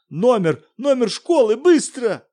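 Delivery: a high, loud demand; срочно, громко; urgent shout. Acted. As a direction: press her hard for the number.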